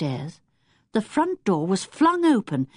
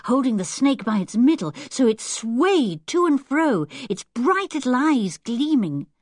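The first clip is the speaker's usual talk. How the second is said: usual speaking voice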